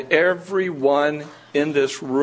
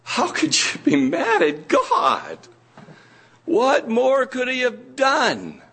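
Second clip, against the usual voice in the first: much higher, pleading disbelief; incredulous rebuke; 7 semitones higher than usual. Retold or imitated. Pastor retelling his reply to the angry believer, higher and incredulous. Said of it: "And I said".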